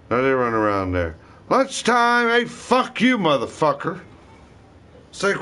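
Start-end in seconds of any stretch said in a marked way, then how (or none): 1.5-4.0 retold shout.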